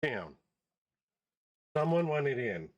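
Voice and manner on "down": "Down." dropped low and firm; short, low, firm "Down."; firm command. Real, not acted. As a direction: lower and settle it: "Down.". Repeats command to dog.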